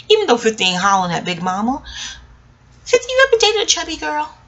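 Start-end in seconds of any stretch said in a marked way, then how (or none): 2.8-4.3 much higher playful flirt; flirty teasing. Pretend. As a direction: tease him flirtatiously. Addressing the actor playfully.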